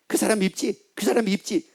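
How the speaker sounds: hint of a needling devil; tempting devil. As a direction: coax like a tempter planting dislike. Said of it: "마귀가 탁 와가지고 얘기합니다".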